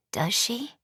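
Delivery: unvoiced whispered question; a hushed inner whisper. A narrator's reading. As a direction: breathe it as a private doubt. Raven's inner doubt.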